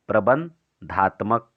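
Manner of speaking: प्रबंधात्मक slowly, split as प्रबन–धात्मक; dictation pace. Shown as a demonstration. Teacher repeating the term for students to write down, प्रबंधात्मक in slow dictation. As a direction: break प्रबंधात्मक in two with a pause after Praban.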